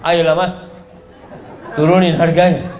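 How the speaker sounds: "Ayolah mas" coaxed with a little laugh; playful coaxing. Shown as a demonstration. Preacher mimics a woman haggling, laughing and coaxing. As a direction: tease the seller down on price. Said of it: "nggak ada kayak gitu".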